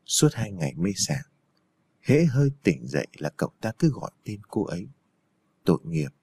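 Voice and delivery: low, hushed monk's voice; lightly voiced old monk, soft with compassion; pitying old monk. A narrator's reading. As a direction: speak low and gently beside the patient.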